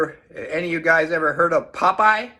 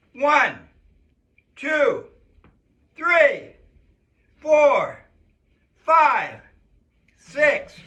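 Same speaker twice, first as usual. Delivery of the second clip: calling out the count; 8 semitones higher, 4 dB louder, much slower than usual. Real, not acted. Coach counting out push-ups.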